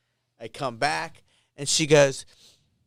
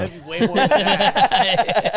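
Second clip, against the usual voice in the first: laughing; 6 semitones higher, 10 dB louder than usual. Real, not acted. Laughing at the joke.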